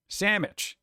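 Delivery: Sandwich said as SAM-mitch; colloquial "sammich". Shown as a demonstration. Streamer showing how people shorten it, Sandwich as "sammich". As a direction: slur Sandwich into "sammich", M replacing the NDW. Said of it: "people say sandwich".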